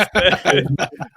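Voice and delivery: loud, high burst of laughter; cracking up at the punchline; big laugh. Real, not acted. Punchline lands.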